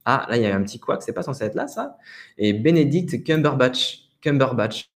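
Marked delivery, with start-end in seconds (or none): 2.5-4.9 "Benedict Cumberbatch" slowly, with French vowels and r; French pronunciation. Shown as a demonstration. Teacher showing the actor's name, à la française. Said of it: "voilà, à la française".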